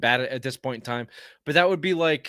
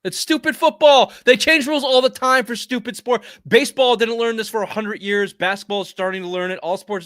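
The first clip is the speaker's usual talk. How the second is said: loud, high-pitched real outburst; indignant, raised-voice sports rant; exasperated rant; 6 semitones higher, 7 dB louder than usual. Real, not acted. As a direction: rail against a stupid rule, voice raised. Exasperated rant about football rules.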